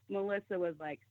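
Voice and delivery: normal talking voice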